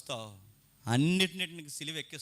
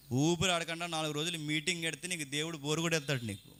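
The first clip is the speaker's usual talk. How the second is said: usual speaking voice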